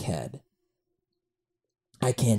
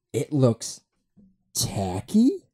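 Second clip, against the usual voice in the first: incredulous echo; much slower than usual. Retold or imitated. Podcaster answering his friend's movie verdict, incredulous. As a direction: throw it back as a disbelieving question. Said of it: "I was like".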